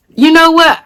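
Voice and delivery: normal talking voice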